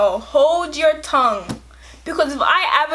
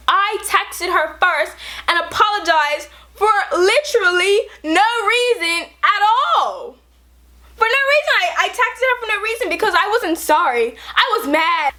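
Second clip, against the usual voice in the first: rising indignation; 5 semitones higher than usual. Real, not acted. Indignant rant about the apology.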